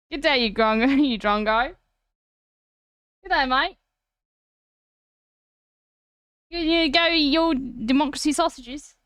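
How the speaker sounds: playful put-on Aussie accent; Australian accent. Streamer trying out an Australian accent for fun, mock Aussie. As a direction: lean into Aussie greetings, lightly hammed-up. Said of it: "using an Australian accent".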